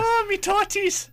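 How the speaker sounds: high, loud, slow fake Scottish old lady; awful Scottish accent. Radio host mocking a Scottish nanny in an awful Scottish accent. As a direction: ham up a bad Scottish granny accent. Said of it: "awful Scottish accent".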